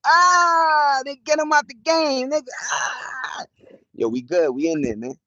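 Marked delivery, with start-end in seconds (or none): 0.1-3.4 high, loud hype cry; hype exclamation. Real, not acted. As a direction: sell the play like it's already won. Hyping a Knicks scenario.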